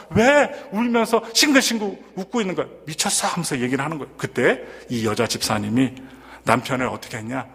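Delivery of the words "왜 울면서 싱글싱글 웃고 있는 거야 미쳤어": startled husband. Retold or imitated. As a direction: blurt it out like a husband shocked awake. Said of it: "놀란 남편은요".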